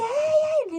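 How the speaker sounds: loud, high wailing "ay"; retold scream. Owner screaming over her run-over dog, high loud wail. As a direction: act out screaming in distress, voice shooting up. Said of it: "Entonces empiezo a gritar de que".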